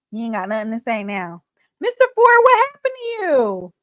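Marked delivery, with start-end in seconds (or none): 1.8-3.7 high, bright call-out to a friend joining the line; surprised high hello to the caller; excited greeting. Real, not acted.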